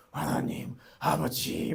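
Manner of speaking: slow, drawn-out mock plea; sighing prayer. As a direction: call on God with a heavy sigh. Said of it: "막 한숨만 넣는 기도". (한숨 쉬며 길게 부르는 기도 흉내)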